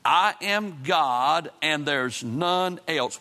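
solemn scripture reading. Real, not acted. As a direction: read the verse slowly, letting each phrase land. Reads the scripture verse aloud.

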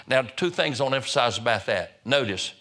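ordinary delivery

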